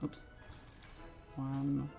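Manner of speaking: ordinary delivery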